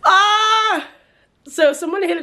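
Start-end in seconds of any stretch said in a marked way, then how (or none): 0.0-0.9 sudden high cry of frustration; frustrated yelp. Real, not acted. Vlogger whose power is out, crying out in frustration. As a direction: let out a sharp anguished "ah".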